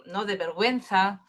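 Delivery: usual speaking voice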